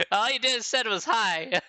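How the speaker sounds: high, loud, slow words through laughter; laughing. Real, not acted. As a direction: say it while cracking up. Amused reading student's email.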